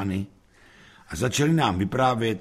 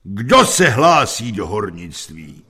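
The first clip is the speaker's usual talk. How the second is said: loud, slow, booming question; mighty voice; 8 dB louder, slower than usual. A narrator's reading. Teacher asking the class who will be miners, mighty voice. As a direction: boom it out with ponderous weight. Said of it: "znovu mohutným hlasem".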